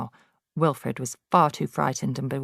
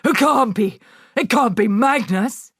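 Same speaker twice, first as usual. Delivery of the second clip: higher, louder, aghast; horrified denial; 7 semitones higher, 6 dB louder than usual. Acted. Headmistress denying the ghost, aghast. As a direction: deny it in panic. Trunchbull in horror.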